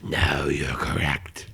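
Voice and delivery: very low, slow creepy voice. Acted. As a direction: drop into a slow creepy growl. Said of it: "I get one creepy voice".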